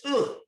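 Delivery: high-pitched mock grunt of disgust; joke-teller's yuck noise; disgusted ugh. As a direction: recoil with a short, high "ugh". Acts barman disgusted by snail.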